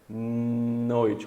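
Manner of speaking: noite opened on a long nasal N; drawn-out N. Shown as a demonstration. Teacher isolates the N for students, noite with a drawn-out N. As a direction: hold the N before finishing noite. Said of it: "Qual que é o som do N".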